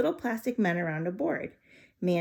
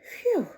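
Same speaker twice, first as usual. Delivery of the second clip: high relieved phew puffed out; squeaky phew; 3 dB louder than usual. Acted. Hot room, hamster relief.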